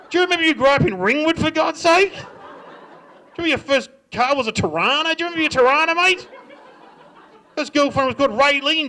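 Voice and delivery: loud, high-pitched incredulous rant; hammed-up indignant shouting; exasperated outburst. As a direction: remind your brother where he came from, yelling. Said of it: "You're like".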